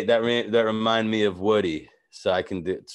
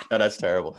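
normal talking voice

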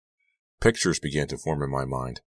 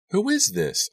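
much higher, alarmed, repeated ask; frightened question. A narrator's reading. Girl on phone hears sobbing, much higher alarmed. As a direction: panic creeping into the question. Frightened call answering sobs.

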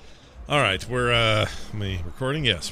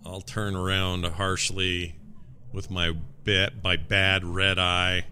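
ordinary talk

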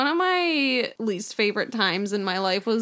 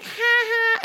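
put-on drunk giggle; 13 semitones higher than usual. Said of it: "she was like".

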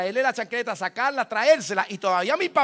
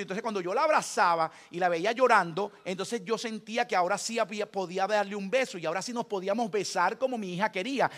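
usual speaking voice

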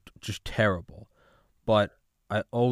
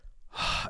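a long, breathy sigh of relief; relieved sigh; 6 dB quieter than usual. Retold or imitated. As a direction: let it all out in one exhale. Arriving at hotel, relaxing.

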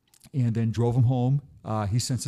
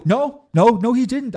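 high, loud insisting no; emphatic no; 11 semitones higher, 8 dB louder, slower than usual. Retold or imitated. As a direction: protest loudly and high. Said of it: "I'm like, I go".

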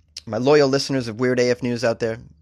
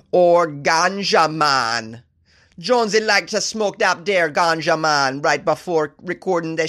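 higher, slow put-on Jamaican accent, self-admittedly not great; 6 semitones higher, much slower than usual. Podcast host plugging his Patreon in a hammed-up Jamaican accent. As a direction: lay on a hammy stoner Jamaican accent. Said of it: "How's that for a Jamaican accent?".

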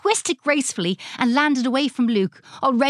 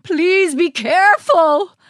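pleading; 6 semitones higher, 6 dB louder, slower than usual. A narrator's reading. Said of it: "Doctor Moore pleaded".

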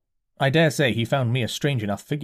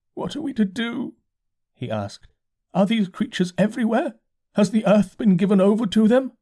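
dazed curate; 10 semitones higher than usual. A narrator's reading. Curate voiced by narrator.

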